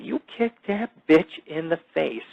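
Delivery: ordinary delivery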